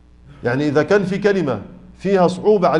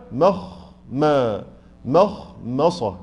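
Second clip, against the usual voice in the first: مخمصة very slow, syllable by syllable; split slowly; 4 semitones lower, much slower than usual. Shown as a demonstration. Teacher splitting a hard word. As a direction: break مخمصة apart slowly. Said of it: "أقسمها برضو".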